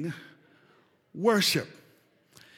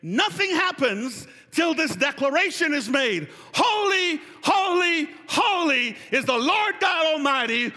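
high, loud proclamation to the congregation; ringing, fervent scripture declaration; full-force preaching; 10 semitones higher, 5 dB louder than usual. Real, not acted. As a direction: declare it at the top of your preaching voice.

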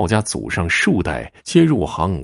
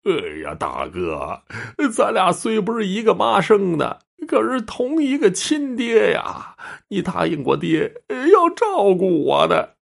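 high, drawn-out theatrical sobbing plea; tearful wailing; 12 semitones higher, slower than usual. A narrator's reading. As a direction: wail to big brother, stretching the phrases out high. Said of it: "哇的哭出声来了".

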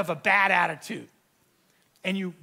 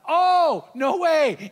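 much higher and louder burst of delight; delighted shock; 8 semitones higher, 8 dB louder, much slower than usual. Retold or imitated. Pastor hearing the engagement news on the phone, loud delight. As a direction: exclaim it like great news just landed.

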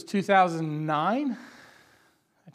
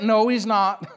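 ordinary talk

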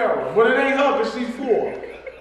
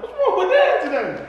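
high-pitched mock child asking about Dad; imitated puzzled kid; 11 semitones higher than usual. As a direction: put on a high kid's voice, puzzled. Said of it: "He gonna just take a shit out of you like".

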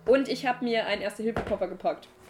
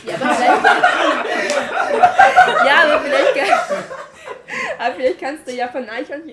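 laughing; 6 semitones higher, 11 dB louder than usual. Real, not acted. Giggling through a joke.

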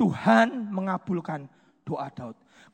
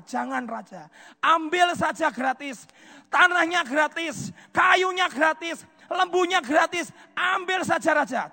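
eager offer; 9 semitones higher, 4 dB louder than usual. Acted. Araunah offers all for free.

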